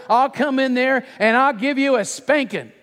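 high, forceful parental threat; roaring. Acted. Dad warning daughter of a spanking, forceful roar. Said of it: "I roared".

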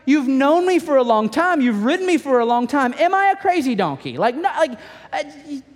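high, pleading donkey voice; talking donkey. Retold or imitated. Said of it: "he's like".

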